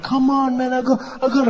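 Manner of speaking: high, strained urging; pleading body. Acted. Body begging comic to let it vomit, high pleading. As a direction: beg as a queasy body. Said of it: "My body was like".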